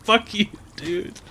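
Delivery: slow mock curse. Comedian curses the cashier, slow and disdainful. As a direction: let it drag with contempt.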